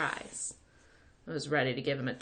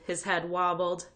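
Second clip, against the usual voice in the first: ordinary delivery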